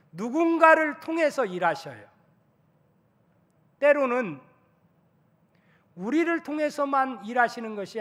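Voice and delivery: fervent preaching. Acted. Pastor stirring the congregation, preaching at full force. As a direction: build each phrase like a pulpit climax.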